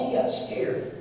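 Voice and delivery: ordinary talk, nothing marked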